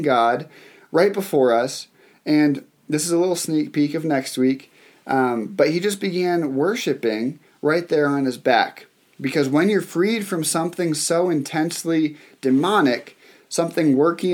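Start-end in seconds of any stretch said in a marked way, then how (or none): none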